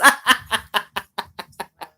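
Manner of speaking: loud helpless laugh; loud laughter. Real, not acted. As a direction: burst out laughing for real. Laughing fit.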